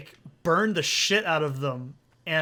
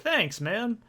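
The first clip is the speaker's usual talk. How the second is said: higher-pitched, breezy retold thanks; casual mock thanks; 6 semitones higher than usual. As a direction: toss off a buddy-style thank-you. Casual reply to dad, then retracted.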